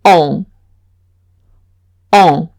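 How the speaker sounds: on as a French nasal vowel; on with the n silent. Shown as a demonstration. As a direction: model the nasal on. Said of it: "se pronunciarían".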